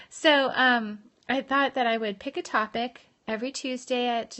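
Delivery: ordinary talk, nothing marked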